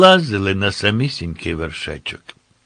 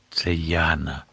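low, soft murmur to a companion; hushed aside; 4 semitones lower, 6 dB quieter than usual. A narrator's reading. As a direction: lower and soften it, as if whispering. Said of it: "шепнув Путята". (стиха, ніби пошепки)

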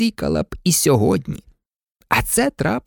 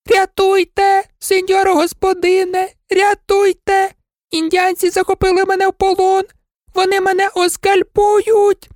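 much higher and louder panicked cry; terrified old maid; 14 semitones higher, 3 dB louder than usual. Acted. Captured maid begs mistress for rescue, high. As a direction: scream for rescue.

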